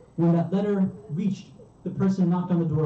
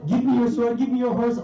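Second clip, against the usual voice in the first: raised, higher-pitched urgent plea; urgent demand; 7 semitones higher, 3 dB louder than usual. Retold or imitated. Wife demanding husband's sword and horse to defend Hussein, urgent. Wife urging Habib to fight.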